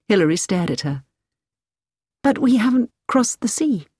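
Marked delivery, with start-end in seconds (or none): 2.2-3.9 higher-pitched startled objection; in surprise. A narrator's reading. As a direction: caught off guard, questioning. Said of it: "she said in surprise".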